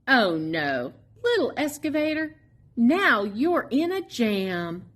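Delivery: ordinary delivery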